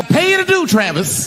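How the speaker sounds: high, loud outburst; hammed-up high-pitched yell; acted yell. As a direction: shoot up high and holler it. Acted yell inside the story.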